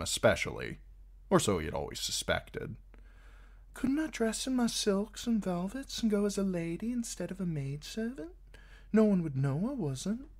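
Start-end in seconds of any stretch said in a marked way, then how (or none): 3.7-10.3 put-on high, light young woman's voice; Shay's voice. A narrator's reading. Shay chattering to Tyrion in bed, high and eager.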